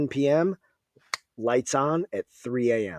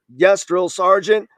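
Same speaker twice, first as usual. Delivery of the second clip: loud, slow, higher yes drill sergeant; barked recruit reply; 6 semitones higher, 6 dB louder, slower than usual. Retold or imitated. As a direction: shout it like a recruit at attention.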